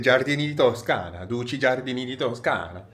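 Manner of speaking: lilting Italian with stretched stressed vowels; attempted Italian accent. Vlogger names a perfume house he hopes to meet, attempted Italian accent. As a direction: have a go at musical Italian. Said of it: "excusez-moi si mon accent n'est pas terrible".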